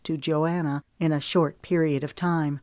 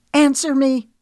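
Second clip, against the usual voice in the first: furious shout, much higher and louder; raised voice; 9 semitones higher, 8 dB louder than usual. A narrator's reading. Lemon demanding Wesley answer, shouting furiously. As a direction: demand it at the top of her voice. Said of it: "Her voice rose".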